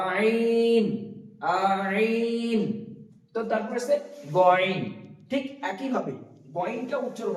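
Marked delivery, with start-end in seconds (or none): 0.1-2.6 আইন from mid-throat, the last drawn long; throaty ain. Shown as a demonstration. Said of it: "বলার চেষ্টা করুন আমার সঙ্গে সঙ্গে". (গলার মাঝ থেকে টেনে আইন)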